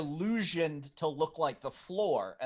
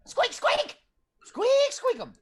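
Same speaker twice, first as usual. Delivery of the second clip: high squeaky rat voice; rubbery high squeaky toy; 4 dB louder than usual. Acted.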